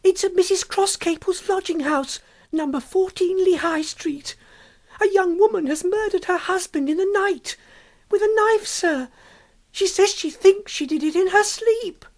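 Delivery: high, pleading panicked woman's voice; begging the police to come, terrified; frantic woman. A narrator's reading. Frantic woman begging the officers to come, high and panicked. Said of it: "A frantic woman ran in with a scream".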